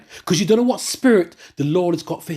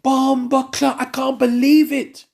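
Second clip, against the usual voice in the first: amazed exclaim; 8 semitones higher, slower than usual. Retold or imitated. Retold amazement at the deed.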